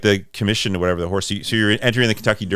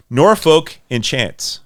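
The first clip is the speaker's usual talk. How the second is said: read slowly; 4 semitones higher, much slower than usual. Shown as a demonstration. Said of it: "If you read that slowly".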